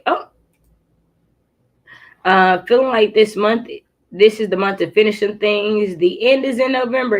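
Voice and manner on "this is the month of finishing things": ordinary talk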